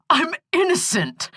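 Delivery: high, pleading protest; crying out. Acted. Accused brother protesting to his lawyer, a high cry. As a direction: insist on it desperately. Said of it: "Skip cried".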